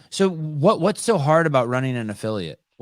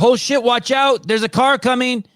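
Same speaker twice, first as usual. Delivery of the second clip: mock yell, high and louder; raised, urgent shout of alarm; urgent yelled warning; 11 semitones higher, 5 dB louder than usual. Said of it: "I want you to yell at me".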